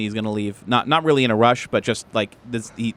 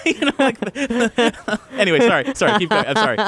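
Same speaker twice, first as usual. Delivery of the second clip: ordinary talk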